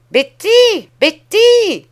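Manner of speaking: very high, loud, sour shouts; cri aigre. A narrator's reading. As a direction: shout the servant's name twice, piercingly. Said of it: "cria la voix aigre". (appels criés d'une voix aigre)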